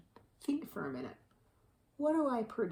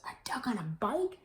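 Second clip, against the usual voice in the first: rising high into disbelief; cow's incredulous thought; 11 semitones higher, 4 dB louder than usual. A narrator's reading. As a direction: voice Cow finding it silly, climbing in pitch. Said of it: "what she thought was".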